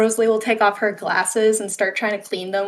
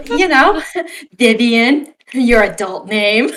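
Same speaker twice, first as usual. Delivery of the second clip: laughing excuse; 4 semitones higher, 5 dB louder than usual. Real, not acted.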